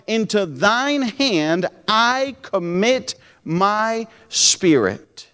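slow solemn reading of the verse; scripture reading. Acted. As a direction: read it like a prayer. Rereading the verse.

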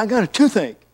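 high, hammed-up complaining voice; mock toothache moan on the phone; whiny toothache caller. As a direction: play the caller whining about his tooth. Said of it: "He said".

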